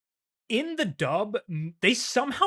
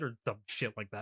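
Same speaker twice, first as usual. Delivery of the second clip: ordinary delivery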